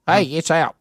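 imitating the robot line judge; robot umpire voice. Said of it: "I wish Gary was that voice".

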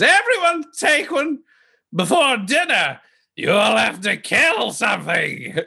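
high, theatrical call to the room; flamboyant host announcing. Acted. Heir offers guests weapons before dinner, high showy proclamation. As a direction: proclaim it high, drawing out the end. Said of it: "He says".

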